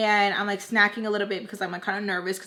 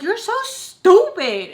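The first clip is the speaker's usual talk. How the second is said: loud, high, slow squeal of outrage; dramatic mad outburst; 10 semitones higher, 10 dB louder, much slower than usual. Retold or imitated. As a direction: yell it theatrically, dragging out STUPID. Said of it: "I get up all dramatic".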